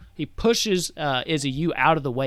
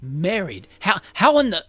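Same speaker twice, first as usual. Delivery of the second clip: incredulous; 6 semitones higher, 7 dB louder than usual. Acted. Father just told his daughter is married, incredulous. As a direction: voice leaping higher and louder.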